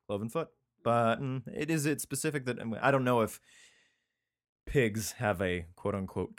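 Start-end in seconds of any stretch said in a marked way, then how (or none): none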